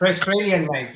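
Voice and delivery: badly faked Australian accent. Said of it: "your Australian accent is trash".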